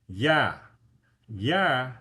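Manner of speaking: "Ya" said loudly as a clear "yah"; Russian letter demo. Shown as a demonstration. Tutor models the Russian letter for learners. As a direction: sound out "Ya" like a teacher. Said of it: "that starts with U".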